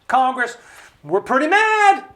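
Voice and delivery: the angry people shouting at Congress; fighting mad. Retold or imitated. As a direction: act the furious voters calling up Congress. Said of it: "fighting mad".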